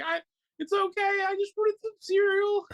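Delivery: very high, squeaky imitation of weeping; mock crying. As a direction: wail it like a comically tearful wife. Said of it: "then just starts crying".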